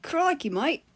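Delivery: Australian [name]. Pretend. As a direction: toss it off in a Crocodile Hunter accent. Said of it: "[name] doing the Crocodile Hunter voice".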